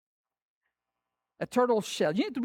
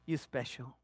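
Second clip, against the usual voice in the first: soft, low and hushed, like a loving father; tender whisper of God; 6 semitones lower, 9 dB quieter than usual. Acted. Preacher voicing God to his church, soft and tender. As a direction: lean in and say it gently. God's words voiced tenderly.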